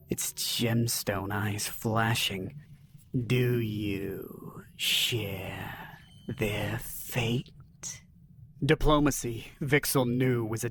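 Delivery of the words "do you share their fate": low, slow, menacing alien ruler. Acted. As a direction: drag each word out like a threat. Alien leader threatens.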